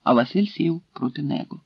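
plain reading voice

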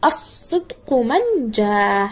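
الصدق slowly as "as-sidqu", long s and no l; initial sun lam. Shown as a demonstration. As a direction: draw out الصدق من جاء, doubling the opening s. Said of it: "مثال للام الشمسية إذا بدأت".

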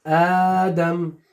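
very slow, drawn-out word; dictating. Shown as a demonstration. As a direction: stretch the word out for spelling. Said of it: "شو بعد جاءتك".

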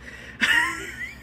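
high squeaky giggle; embarrassed giggle. Real, not acted. Vlogger fearing demonetization over innuendo, giggling. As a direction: giggle helplessly at your own slip.

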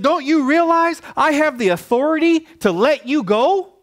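very high, insistent acted question; incredulous demand. Pilate to Jesus about his authority, high and incredulous.

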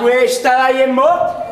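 slow shouted question. Acted. Quarrel in a comedy drama. (domanda gridata lenta)

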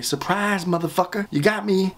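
ordinary delivery

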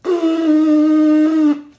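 very high, blaring tube buzz; even-smaller-crest tone. Shown as a demonstration. Teacher showing an even smaller crest, blaring high honk. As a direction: blast a long, high, steady honk. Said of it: "if it's even smaller".

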